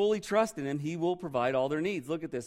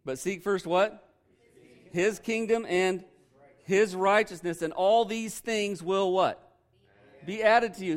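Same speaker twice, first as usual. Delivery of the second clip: louder, higher call for the answer; prompting the crowd to answer, louder; emphatic call-out; 4 semitones higher, 5 dB louder, slower than usual. Shown as a demonstration. Preacher repeats the verse to the congregation, louder and insistent. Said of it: "You guys are lame".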